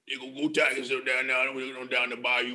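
low, mumbled Cajun growl; half-intelligible Cajun coach impression. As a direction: mumble it deep and slurred in a Louisiana accent. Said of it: "He's like, uh".

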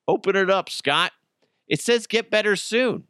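a touch of a gloating manager; smug manager. Acted. Manager tells Scott to open the card, lightly smug. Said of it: "Be like".

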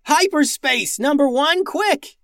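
high, urgent shouted command; breathless yell. A narrator's reading. Captain orders robot navigator to escape, breathless yell. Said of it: "yelled Max, out of breath".

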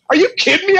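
a rookie who can't believe what he's hearing; shocked disbelief. Retold or imitated. Rookie told to call the bellman, high shocked disbelief. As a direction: pitch jumps way up in disbelief.

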